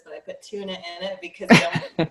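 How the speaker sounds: laughing. Real, not acted. To the host, laughing about putting tuna in oatmeal. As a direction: giggle out the odd admission.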